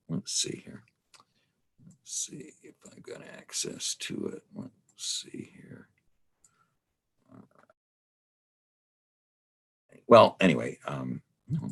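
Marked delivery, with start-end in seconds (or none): 0.1-10.0 very soft, half-whispered murmur; muttering to self. Real, not acted. Lecturer checks whether they have access, muttering to self. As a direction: think aloud under your breath. Searching computer, talking to himself.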